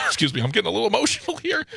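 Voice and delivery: high, quick, strained after coughing; choked by a cough. Real, not acted. Host plugging the shows, joking through a cough as if emotional. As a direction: push the words through a cough, mock-emotional. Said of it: "No, I just have a cough".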